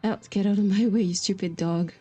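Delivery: ordinary talk, nothing marked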